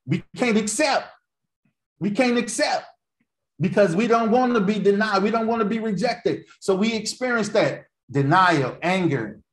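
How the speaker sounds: high-pitched, insistent preaching. Real, not acted. Preacher explaining why we can't accept rejection, insistent.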